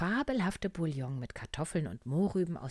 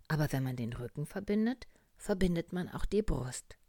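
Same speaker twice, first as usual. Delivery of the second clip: plain reading voice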